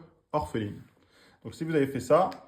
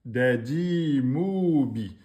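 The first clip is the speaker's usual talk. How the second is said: slow blended reading; much slower than usual. Shown as a demonstration. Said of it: "on a bien dadi moubi".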